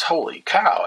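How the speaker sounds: a touch of stunned delight; mock amazement. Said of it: "his reaction then".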